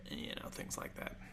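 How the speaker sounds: whispered aside. Real, not acted. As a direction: drop to a secretive whisper. Confiding aside about modded console.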